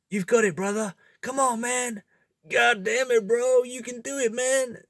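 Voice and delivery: training partner shouting at you, in a high hyped-up bro voice; hyped-up gym bro. Retold or imitated. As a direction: cheer your buddy through the last rep like a gym bro. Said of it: "maybe he doesn't say it in that accent or quite like that".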